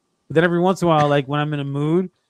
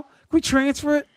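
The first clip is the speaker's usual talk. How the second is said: high, playful fake eagerness; mock eager victim; 12 semitones higher than usual. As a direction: play the gullible mark.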